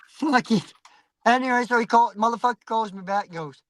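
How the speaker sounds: usual speaking voice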